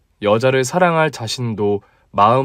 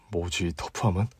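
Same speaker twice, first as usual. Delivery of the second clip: troubled aside under the breath; inner thought; 6 semitones lower, 11 dB quieter than usual. Acted. (혼잣말처럼 작고 느리게)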